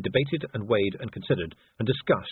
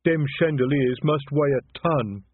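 lumbering troll admiring the place; Detritus, respectful troll; 5 semitones higher, 4 dB louder, slower than usual. A narrator's reading. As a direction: marvel respectfully as a simple troll. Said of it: "gazing around respectfully".

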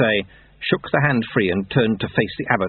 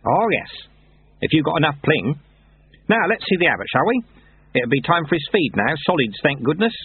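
higher-pitched, gossiping character voice; chatty Lutze; 7 semitones higher than usual. Acted. Lutze chatting on the way to abbot, chatty. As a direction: prattle on to your young companion.

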